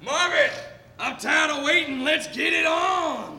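high, yelled call from outside; impatient duelist hollering from the street; shouted challenge. Acted. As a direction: yell for him to come out and fight.

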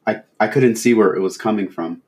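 a touch of distress, forcing words out; shaky voice. Acted. Father recalling abduction to officer, mildly shaky. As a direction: struggle slightly to get the words out. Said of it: "His voice got shakier".

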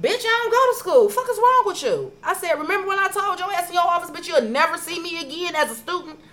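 high, loud mock cussing out; retold yelling. Yelling back at the teacher who asked why she's not in school. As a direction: yell it at the teacher like you mean it.

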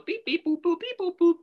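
very high sung beeps and boops like a keypad; mimicked dial tones. GM dialing the Falcons, squeaky keypad beeps. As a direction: chirp out the phone buttons high.

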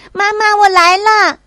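high, loud joyful shout; running to mom, calling ahead. Acted. Child runs toward mother. (跑向妈妈时高声呼喊)

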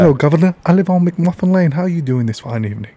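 cheeky British catchphrases to get into the accent. Shown as a demonstration. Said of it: "gets me kinda into the accent".

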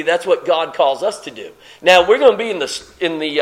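usual speaking voice

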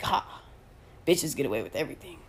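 low, fast sneering whisper; mock gloating whisper. Mocking a troll who gloats online, in a low whisper. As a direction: hiss it like a smug troll gloating.

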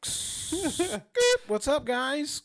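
very high, loud muffled bubble voice. Pretend. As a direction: squeal as if trapped in a bubble. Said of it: "Is that me in, like, in a bubble?".

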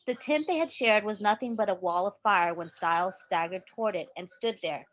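plain reading voice

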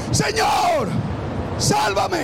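very high, loud scream; desperate cry for help. Acted. Peter sinking in the waves screams to Jesus. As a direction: scream for rescue while sinking.

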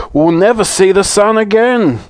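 high, loud frightened wail; despairing. A narrator's reading. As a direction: cry out as if all is lost. Everyone quakes and quivers.

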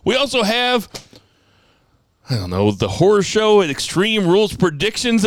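punchy podcast announcer read; show announcer. Acted. Host announces tonight's podcast topics to listeners, announcer style. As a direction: hype up tonight's line-up. Reads the show's opening rundown.

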